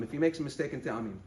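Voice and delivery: normal talking voice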